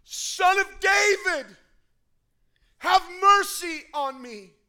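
high, loud, slow desperate cry; screaming plea. Acted. Blind beggar screaming to Jesus for mercy. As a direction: scream for mercy across the crowd. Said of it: "Bartimaeus is screaming".

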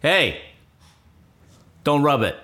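loud, high, curt father voice; gruff father. Retold or imitated. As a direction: snap at the crying boy. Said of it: "Little boy's crying".

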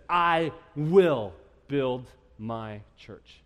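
drawn-out, weighty "I will build my church"; emphatic quote. Shown as a demonstration. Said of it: "And Jesus says".

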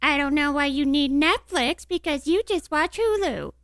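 put-on high-pitched little spitfire kid; imitating youngest child. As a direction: mimic a sassy kid piping up, pitched high. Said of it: "our youngest little Spitfire pops up and goes".

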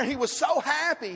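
usual speaking voice

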